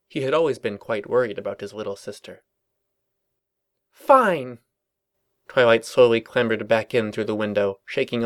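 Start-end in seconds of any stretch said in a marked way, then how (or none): 3.9-4.6 sulky snap. Acted. Twilight caught in net, sulking.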